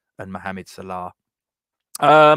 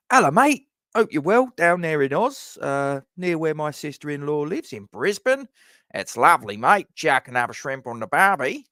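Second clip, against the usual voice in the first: higher, hammed-up Australian greeting; playful attempt at an Australian accent; Aussie accent; 5 semitones higher, 4 dB louder than usual. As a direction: put on a chummy Aussie accent, pitch swooping up. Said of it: "Did I do the Aussie accent right".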